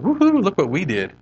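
high, mocking whoop of self-praise; mocking teams who high-five themselves, mock cheer. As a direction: ham up a smug little victory cheer.

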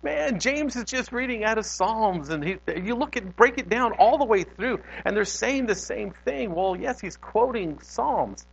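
excitedly retelling a revelation in a high voice; excited discovery. Retold or imitated. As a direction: relive the thrill of piecing it together. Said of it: "This is what got me excited when I was like".

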